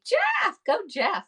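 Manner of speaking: high loud whoop of his name; cheering. Real, not acted. Host cheering for Jeff in the lineup, whooping high and loud. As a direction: cheer him on like a fan.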